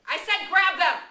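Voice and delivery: very loud, high furious yell; angry shout. Acted. Jack shouting at his hesitant tribe, enraged. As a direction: force them to obey. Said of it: "Jack shouted angrily".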